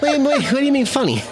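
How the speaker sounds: quick, high wiseguy challenge; Jersey tough guy pouncing on a remark; Joe Pesci. Acted. Wiseguy challenging his friend over a compliment, prickly Pesci act. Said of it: "[name]'s second language is Joe Pesci".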